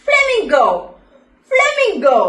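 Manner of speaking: bright emphatic model word; clear teaching word. Shown as a demonstration. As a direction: call flamingo out high and clear for children to repeat. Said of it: "babies പറഞ്ഞേ".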